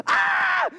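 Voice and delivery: short high-pitched scream; retold scream. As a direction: let out a startled, high yelp. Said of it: "And I scream".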